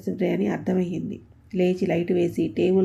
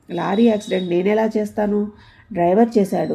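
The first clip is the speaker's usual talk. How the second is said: plain reading voice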